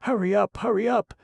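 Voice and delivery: very high, loud urgent plea; breathless begging. Acted. Betsy pulling Carter away, breathless frightened begging. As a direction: beg him to hurry, out of breath. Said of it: "she begged breathlessly".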